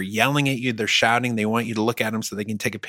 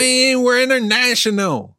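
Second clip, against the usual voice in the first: hyped; 13 semitones higher, 4 dB louder than usual. Acted. Echoing hype voice.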